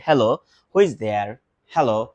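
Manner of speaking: ordinary talk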